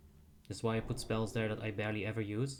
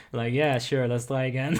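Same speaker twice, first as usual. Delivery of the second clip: louder, through bursts of laughter; laughing; 3 semitones higher, 7 dB louder than usual. Real, not acted. Player shrugging off a wipe from bad RNG, laughing. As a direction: laugh it out loud, amused at the bad luck. Laughing over getting RNG'd, wiping.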